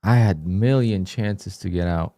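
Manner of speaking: ordinary talk, nothing marked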